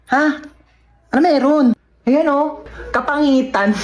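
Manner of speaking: ordinary talk, nothing marked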